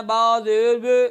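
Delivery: slow mistaken rounded vowel; wrong rounded zer. Shown as a demonstration. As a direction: round the lips on बे. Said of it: "या होंठों को गोल कर देना".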